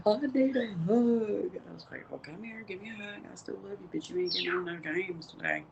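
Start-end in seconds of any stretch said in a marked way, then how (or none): 0.1-1.5 put-on high, slow, drawn-out kid plea; put-on pitiful kid voice; upset child. Retells upset child wanting hug. 2.1-5.6 retold tender parent, easing back to normal; retold comforting parent. Retells comforting child after hug.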